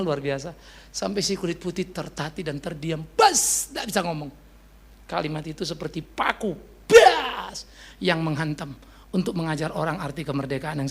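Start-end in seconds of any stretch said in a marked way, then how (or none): 3.1-3.7 sudden loud high "bas!"; one explosive burst; imitated slam. Sudden exclamation imitating a blow. 6.9-7.7 loud high "bas!" like a nail driven in; sudden hammer-blow sound; imitated impact.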